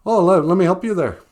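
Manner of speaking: high, loud eager offer; a big friendly bear rushing to help; helpful bear. Acted. Bear offering to free the rabbit, high and eager.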